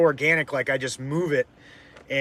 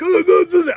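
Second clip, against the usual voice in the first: much higher, louder mocking ugh sounds; mocking grunts; 9 dB louder than usual. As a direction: jeer like the tipsy buddy. Buddy taunting, mocking noise.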